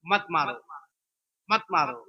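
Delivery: gentle plea. Acted. Preacher voices pleading not to hit.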